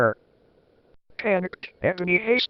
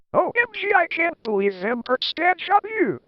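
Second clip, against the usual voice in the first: mock gasp; 9 semitones higher than usual. A narrator's reading. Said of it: "One Eye gasped".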